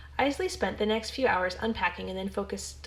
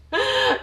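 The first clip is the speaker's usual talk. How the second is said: real laugh; 10 dB louder than usual. Real, not acted. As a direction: burst of high loud laughter.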